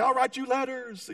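high, hammed-up parting promise; groom calling back. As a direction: call it back to a clinging sweetheart. Groom calls back to pleading bride.

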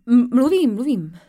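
stumbling, forced-out stammer. Acted. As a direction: squeeze the words out haltingly. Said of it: "vymáčkla jsem konečně".

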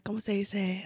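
quiet Spanish aside searching for a word; switching languages midsentence. Real, not acted. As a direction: mutter in Spanish, lost for words. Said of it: "what's that word?".

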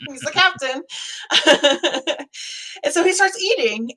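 ordinary talk

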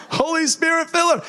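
high, ringing call on Jesus; high praying call laying hands on the lady; commanding prayer. Retold or imitated.

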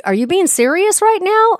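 high, incredulous retold question. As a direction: disbelief rising to a squeak. Retold shock at his claim.

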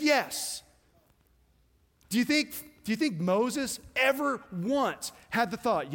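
high, loud pulpit preaching driving every line; full-force preaching. Acted.

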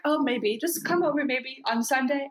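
lightly acted, offhand high-pitched asking; Carol. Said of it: "whatever she asks a question to Therese, she's like".

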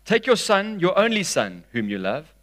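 projected reading without long pauses; scripture reading. A narrator's reading. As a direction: read the verse onward in flowing phrases. Resumes reading the verse in flow.

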